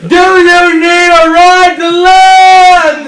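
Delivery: loud, high, very slow holler; bellowing shark voice. Acted. Shark offering castaways a ride, bellowing shark voice. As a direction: stretch each word out as a giant friendly shark.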